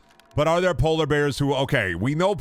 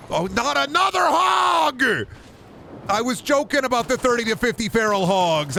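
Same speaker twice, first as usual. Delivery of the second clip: shrill panicked voice; 9 semitones higher than usual. Acted. Shrieky voice scolding hogs.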